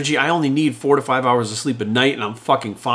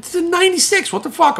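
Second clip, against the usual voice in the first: exasperated. Real, not acted. Exasperated rant about grandfather.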